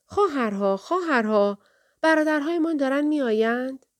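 high call to the sisters, last line held up; crying out. A narrator's reading. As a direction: call anxiously up to the lookouts, pitch climbing.

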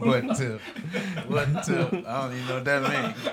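words bubbling through laughter; laughing. Real, not acted. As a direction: laugh as you ask it. Laughing at the story.

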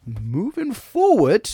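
slow, high, drawn-out host announcement; announcer flourish. Acted. Host segment transition.

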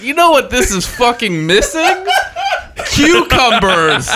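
laughing through a sarcastic quote; mock-serious question, cracking up. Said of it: "was like".

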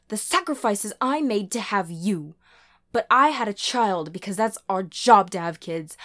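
loud, high, furious tirade; forceful, heated outburst; desperate ordering. A narrator's reading. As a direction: rail at your child, ending in a command. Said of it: "She all but ordered".

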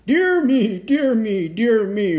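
loud, high Santa roar, stepping down in pitch. A narrator's reading. Santa landed in the front yard, roaring. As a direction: roar it in big repeated bursts. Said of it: "roared Santa".

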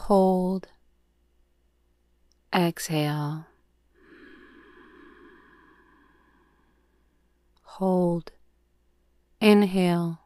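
calm, measured yoga nidra guide; soothing meditation voice, words evenly spaced; guided meditation. Acted. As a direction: lead the breath with long, unhurried pauses.

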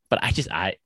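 halting, with restarted words; stammering. Real, not acted. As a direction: stumble over your words and restart. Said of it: "I'm s-, stammering".

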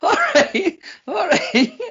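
very high, squeaked through laughter; helpless laughing. Real, not acted. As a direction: give in while cracking up. Laughing at co-host's outburst.

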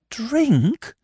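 high, appalled question; shuddering. A narrator's reading. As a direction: recoil from the word, pitched high. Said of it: "Eve repeated, with a little shudder".

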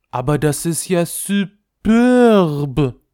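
hoch, laut und langsam geschwärmt; schwärm wie eine verwöhnte Prinzessin; entzückte Prinzessin. A narrator's reading. Prinzessin hört die Knarre. (hohe, schwärmende Prinzessin)

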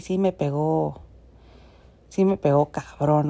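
usual speaking voice

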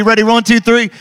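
loud, high countdown like a crazy moviegoer; yelling count. Acted. Preacher counts the crowd in to yell, loud and high. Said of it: "like that crazy person at a scary movie yelling".

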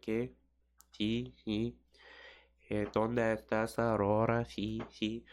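lightly mocked lazy Mexican Spanish. Mexicans chatting in Spanish, lightly mimicked lazy Mexican accent. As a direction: put on a slack Mexican accent a little. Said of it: "Mexicans speak some lazy Spanish. They're like".

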